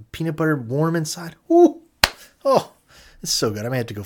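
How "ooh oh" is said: very high, loud squeal of delight; delighted ooh. Real, not acted. Describing a toasted Uncrustable to viewers, high delighted ooh. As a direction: swoon over the warm peanut butter.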